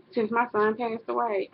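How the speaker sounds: ordinary talk, nothing marked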